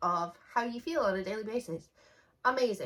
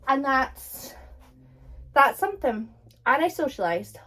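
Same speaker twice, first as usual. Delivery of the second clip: proud rant; 3 semitones higher, 9 dB louder than usual. Real, not acted. Proud rant after the walk.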